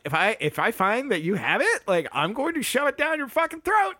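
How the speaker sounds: mock yell. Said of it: "she's, like, yelling".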